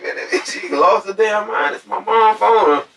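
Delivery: high, slow exasperated retelling; amazed, slow and high. Retold or imitated. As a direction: stretch it out, high and fed up. Telling brother about the woman.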